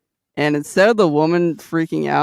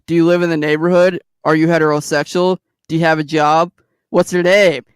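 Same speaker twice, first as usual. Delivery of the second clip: quick, louder rapid-fire questions; mock eager date questions; 5 dB louder than usual. A woman grilling Bud like a first date, mock eager. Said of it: "And she's like".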